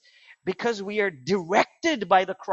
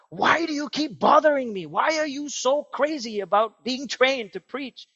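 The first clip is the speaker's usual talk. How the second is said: irritated retort; 7 semitones higher, 3 dB louder than usual. Retold or imitated. Spurgeon snapping at pestering man.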